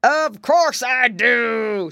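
loud, slow, drawn-out growling bear; bear's growl. A narrator's reading. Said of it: "suddenly growled a voice".

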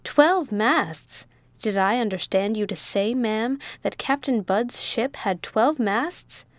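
ordinary delivery, nothing marked

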